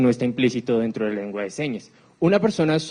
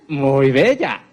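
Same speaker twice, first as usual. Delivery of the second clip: louder, drawn-out admiring muy bella; 5 dB louder, much slower than usual. Shown as a demonstration. Lecturer shows muy bella with full expression, admiring. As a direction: gush muy bella as if truly impressed. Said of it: "Esas expresiones faciales".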